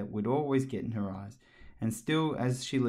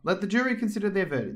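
fast, high weary king; 9 semitones higher, 4 dB louder, faster than usual. A narrator's reading. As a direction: rattle off the royal order. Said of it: "the king said".

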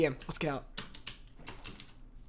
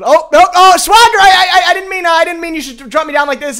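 panicked protest; 3 semitones higher, 7 dB louder than usual. Real, not acted. Panics as Swagger drops them.

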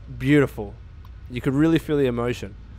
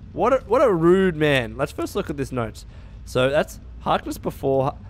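ordinary talk, nothing marked

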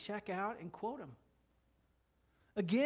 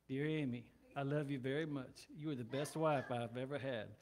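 low, mechanical monotone, as a mock reply; rote recitation; 6 semitones lower, 4 dB quieter than usual. Husband gives his wife the identical reply, droning recital. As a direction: repeat it by rote, low and lifeless. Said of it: "responded to Amy with the same thing every time".

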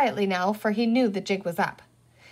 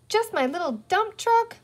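put-on high, small-boy plea; pleading; 11 semitones higher than usual. A narrator's reading. Boy begs his sister for one toy, pleading. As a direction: beg hopefully in a little high voice.

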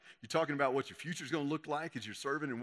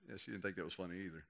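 ordinary talk

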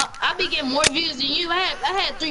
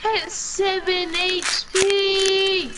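drawn-out cheer; 7 semitones higher, 5 dB louder than usual. Real, not acted. Clutch win in game.

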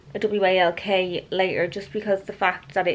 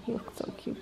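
cooing whisper; 15 dB quieter than usual. Real, not acted. Owner admiring the pug in a little towel, cooing whisper. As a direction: whisper it adoringly.

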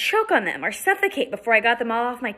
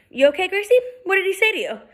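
put-on higher boy's voice, checking in; boy checks on Gracie, light higher voice; lightly voiced concerned boy; 10 semitones higher, 3 dB louder, faster than usual. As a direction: ask gently if she's okay.

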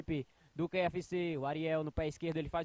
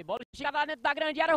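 excited; 8 semitones higher, 7 dB louder, faster than usual. Real, not acted. Attack builds in play-by-play.

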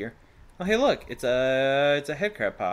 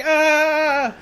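high panicked scream; 7 dB louder than usual. Pretend. Enemy soldiers fleeing the player, shrieking in panic. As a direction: shriek in terror. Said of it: "They're like".

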